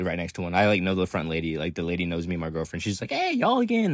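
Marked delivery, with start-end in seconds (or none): 3.1-3.9 mock cheery greeting, pitched much higher; cheery cashier greeting. Walmart front lady greeting regulars, mock cheery and high. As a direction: lightly imitate a chirpy front lady.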